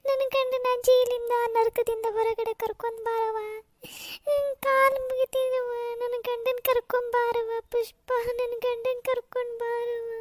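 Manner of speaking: slow, wailing plea; tearful begging. Acted. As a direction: beg through tears, dragging out words. Mother begs for husband's release. (ಅಳುತ್ತಾ ಅಂಗಲಾಚುವುದು)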